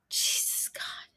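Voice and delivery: whispered oath; hushed, voiceless exasperation; exasperated whisper. Real, not acted. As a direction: whisper it to yourself in disbelief.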